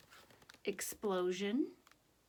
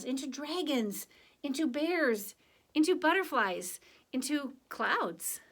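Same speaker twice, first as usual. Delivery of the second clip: put-on lively, higher read-aloud voice for children; story narration; 3 semitones higher, 3 dB louder than usual. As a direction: tell it to the kids with a sense of wonder. Reads picture book to class.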